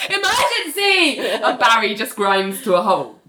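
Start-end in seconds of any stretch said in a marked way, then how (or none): 0.1-1.2 high, hammed-up urgent cry; frantic plea. Richard drags John to his office, high frantic cry. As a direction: cry it out high in mock panic.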